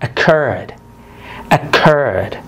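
occurred with CURRED stressed; stress on second syllable. Shown as a demonstration. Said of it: "أضاعف".

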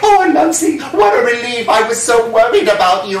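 a hammed-up wife melting with relief; relieved wife. Wife finding Anansi safe, high relieved voice.

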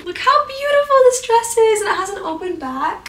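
high, loud delighted gush; excited gush. Real, not acted. Vlogger shows viewers a new dress, gushing.

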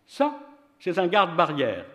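man's voice. A narrator's reading. Man's reply in dialogue.

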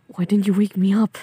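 loud, irritated reproach; annoyed yell. Acted. Overslept speaker blames partner for not waking them, annoyed yell. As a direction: raise your voice in annoyance. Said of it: "didn't mean to yell at you".

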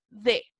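D sounded "deh", with a hard d; DE as in Spanish. Shown as a demonstration. Teacher contrasting other positions of DE, pronounced Spanish "deh". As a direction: say D as plain Spanish "de", no "dji". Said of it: "pronunciar igual que en español".

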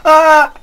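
high, loud scream of panic. Real, not acted. Being killed.

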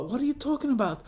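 quick, high-pitched bewildered question; baffled demand. Acted. Mother to wailing daughter, baffled and quick. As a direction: fire back in confusion.

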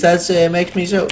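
usual speaking voice

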